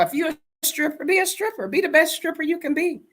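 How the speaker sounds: high, insistent push; emphatic. Real, not acted. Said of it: "emphatic advice".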